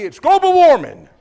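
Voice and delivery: loud, high, scornful punchline; mock the excuse out loud; mock answer.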